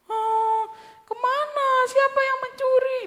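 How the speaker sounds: a grieving woman searching for her Lord; distressed cry. Acted. Maria weeping, finds body gone. (cemas dan sedih, suara tinggi)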